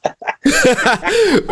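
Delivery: real high laugh; amused laugh. Real, not acted. Podcast hosts laughing over frantic button pushing, amused laugh.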